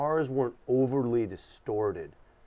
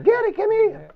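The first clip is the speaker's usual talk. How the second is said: high loud Yiddish grandma call; Yiddish grandma accent; 9 dB louder than usual. Retold or imitated. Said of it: "And that was her accent".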